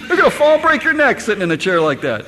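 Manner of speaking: loud parental warning; scolding parent. Retold or imitated. Parent warning child about the chair, loud scolding. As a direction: warn the kid he'll break his neck. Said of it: "How often do we say that?".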